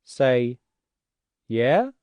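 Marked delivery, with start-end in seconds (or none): 1.5-1.9 questioning rise, called upstairs; rising call. Shown as a demonstration. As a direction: call up the stairs with a rising "Yeah?". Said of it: "Answer that person who's downstairs".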